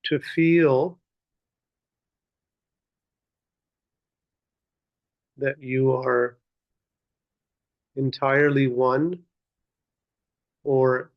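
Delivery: measured meditation guidance with long pauses; guided meditation. Real, not acted. Teacher leading seated listeners in muraqabah, guiding contemplatively. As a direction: guide them inward, one unhurried phrase at a time.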